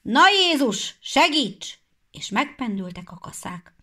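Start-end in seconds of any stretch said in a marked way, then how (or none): 0.0-1.8 loud, high rallying call; loud call to start the harvest. Acted. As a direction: rouse the workers loudly. Reapers calling out to start.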